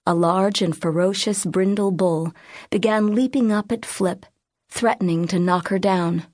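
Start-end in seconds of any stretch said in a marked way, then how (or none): none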